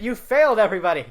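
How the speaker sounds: high, loud mock announcement; loud teasing. Real, not acted. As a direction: call it out to everyone.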